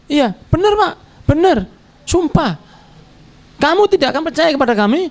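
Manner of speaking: high, pleading insistence; insistent pleading lie. Acted. Sons swearing to their father the wolf ate Yusuf, pleading high. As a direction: swear it like a guilty son begging belief. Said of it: "brothers insisting on lie".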